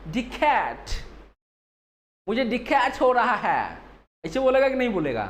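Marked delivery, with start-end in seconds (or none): 0.1-3.8 put-on Englishman mangling Hindi, with a British accent. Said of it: "अंग्रेज जैसे हिंदी कैसे बोलेगा?".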